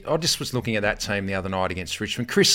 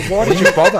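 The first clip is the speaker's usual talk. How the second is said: mock disbelief; 9 semitones higher, 10 dB louder than usual. Mocking the Bundy drinkers.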